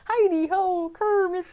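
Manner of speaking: high puppet greeting; Muppet impression. Co-host groping for a Muppet catchphrase, high voice. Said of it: "what does he say?".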